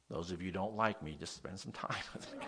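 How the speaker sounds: amused self-deprecating aside; laughing joke. Real, not acted. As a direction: chuckle as you say it.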